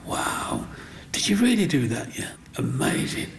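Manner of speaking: awed whisper. Retold or imitated. Shopkeeper awed by tiny carving.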